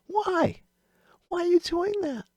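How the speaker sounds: very high, exaggerated questioning; mock incredulity. Trader questioning a girl's tiny stop, mock incredulous.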